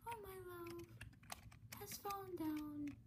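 soft, sad lament. Acted. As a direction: sigh it out sadly. Reading game line.

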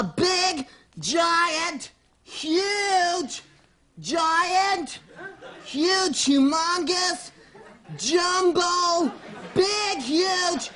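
slow, relish every size word; drawn-out exaggeration. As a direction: stretch each word out hugely, pausing between them. Piles up exaggerated size words.

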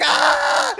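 high loud yelp of pain; short high cry of pain; mock scream. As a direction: scream like a stabbed bird. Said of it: "He's like".

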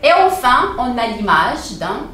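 ordinary delivery

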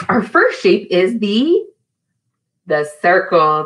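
ordinary talk

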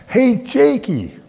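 high, loud, drawn-out call to a dog; excited calling out. Retold or imitated. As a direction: call the dog over like a delighted child. Child calls favourite dog across pool.

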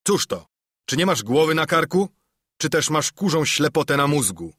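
long John bristling at the dim sailor; indignant outburst. A narrator's reading. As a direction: scold him for his thick head. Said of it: "obruszył się długi John".

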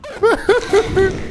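high gleeful villain giggle; evil giggle. Pretend. Monster mocks a player, high giggle. Laughs at victim.

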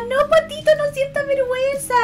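high, loud cutesy reassurance; e-girl voice. Acted. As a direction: coo at him sweetly. Comforting chatter in high voice.

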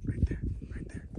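hushed whisper, spotting game; breathy urgent hunting whisper; hunter's whisper. Real, not acted. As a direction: whisper so the elk won't hear. Spotting elk while hunting.

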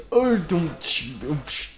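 ordinary delivery

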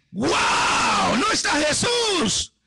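high, astonished cry of discovery; shouting out in acted amazement; amazed shout. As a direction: exclaim it high and drawn out. Disciples find the tomb empty.

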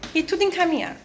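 ordinary talk, nothing marked

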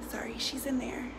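unvoiced quick aside; whisper. Real, not acted. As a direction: whisper an apology.

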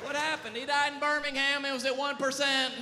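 high, loud mock-tragic report; mock dramatic. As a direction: announce it like a tragic death. Mock-dramatic phone death report.

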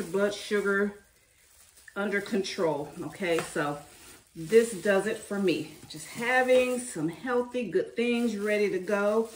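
ordinary talk

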